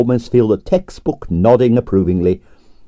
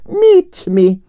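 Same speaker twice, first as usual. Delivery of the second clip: Russian diva. Acted. Arienskaya's voice.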